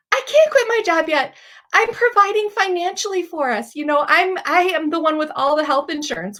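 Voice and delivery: high, rising, frantic objection; panicked protest. Retold or imitated. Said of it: "in Christy fashion".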